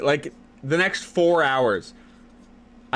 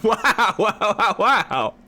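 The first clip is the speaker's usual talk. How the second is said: laughing wow; 8 semitones higher, 5 dB louder, much slower than usual. Retold or imitated. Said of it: "He said".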